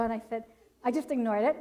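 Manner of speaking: ordinary delivery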